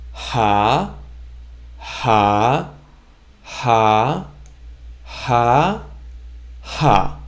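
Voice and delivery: short Hebrew syllable "ha" with an audible h, repeated. Shown as a demonstration. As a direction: say "ha" with a clear breathy h five times. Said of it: "let's say this hey five times together".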